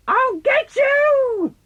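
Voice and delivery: sudden very high loud shout; dad pounces on his son in a chase game; pouncing roar. Retold or imitated. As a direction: burst out and grab.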